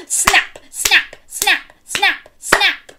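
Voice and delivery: crocodile snapping. Shown as a demonstration.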